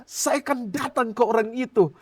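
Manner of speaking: mimicked prophet building in force; imitated prophet. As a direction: declare God's verdict on someone. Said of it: "terus dia bilang lagi".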